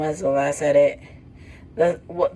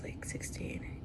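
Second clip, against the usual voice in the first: whispered; 20 dB quieter, slower than usual. Real, not acted. Aside about age.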